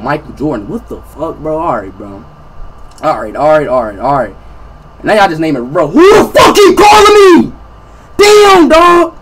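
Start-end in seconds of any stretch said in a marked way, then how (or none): 5.9-9.2 loud strained yell. Real, not acted. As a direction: yell it in frustration. Swarmed by calls, yelling.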